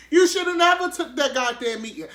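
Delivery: high, loud fake crying wail; badly faked tearful lament; mock sobbing. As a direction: sob the line in hammed-up despair. Repeats lament in mock tears.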